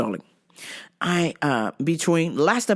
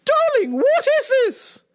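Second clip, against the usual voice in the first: much higher indignant mom voice; mom impression; 10 semitones higher than usual. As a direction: play the offended mom demanding her points. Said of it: "And I sent her the message".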